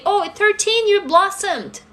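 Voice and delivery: sarcastic high-pitched stepmom imitation; mocking stepmom. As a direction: parody a stepmom's cheerful family line. Mocking family myth, stepmom.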